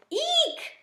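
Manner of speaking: very high eek, squeaked; a tiny hamster shriek of alarm; a frightened hamster's high squeak; startled squeak. A narrator's reading. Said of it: "I squeaked".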